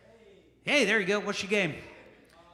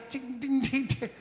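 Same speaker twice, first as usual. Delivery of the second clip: triangle dings; 6 semitones higher, slower than usual. Shown as a demonstration. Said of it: "remember the triangle".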